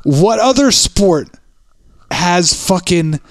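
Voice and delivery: slow, careful, choosing each word; biting tongue. Shown as a demonstration. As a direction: pick every word with care, slowly. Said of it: "choose my words carefully".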